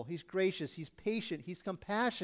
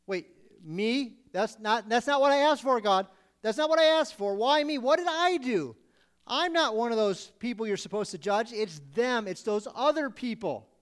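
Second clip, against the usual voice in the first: indignant shock; 3 semitones higher, 4 dB louder than usual. Retold or imitated. Indignant worshipper caught out.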